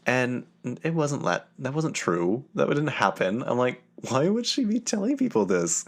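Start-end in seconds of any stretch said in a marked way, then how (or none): 4.1-5.8 very high-pitched incredulous question; retold disbelief. To friends about the worker's claim, high incredulous mock reaction. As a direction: replay your baffled reaction, pitched way up.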